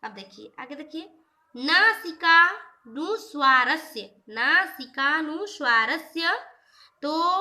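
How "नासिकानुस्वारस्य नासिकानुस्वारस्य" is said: slow, louder chanting of the Sanskrit rule; sutra recitation. Shown as a demonstration. Teacher reciting the rule to students, slow and louder. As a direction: intone the sutra slowly for the students.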